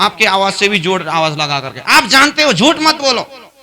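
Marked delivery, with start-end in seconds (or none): 1.8-3.5 higher and louder, outshouting the listener; louder insistence. Acted. Teacher raising his voice to outtalk a stubborn student, higher and louder. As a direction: push over his voice, firm and raised. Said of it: "फिर मैं जोर से बोलता हूं".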